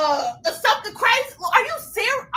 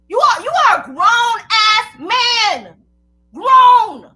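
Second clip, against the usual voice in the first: furious shout; 9 dB louder, much slower than usual. Real, not acted. Yelling at old man.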